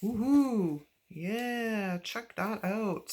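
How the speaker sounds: high whoop, then slow delight; excited cheer. Real, not acted. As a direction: cheer it out, thrilled. Excited by gold marker.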